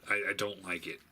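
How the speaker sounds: usual speaking voice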